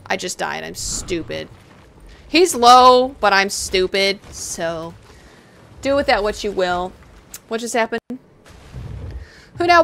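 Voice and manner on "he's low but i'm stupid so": ordinary delivery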